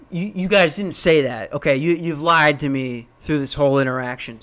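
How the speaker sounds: normal talking voice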